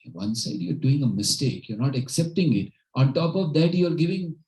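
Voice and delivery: indignant mock rebuke, pitch climbing; retold angry king. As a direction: act out a king berating his servant. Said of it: "Now king was again angry".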